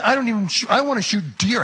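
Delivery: high, loud protest; indignant protest. Acted. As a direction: object in disbelief. Protests against shooting the mule.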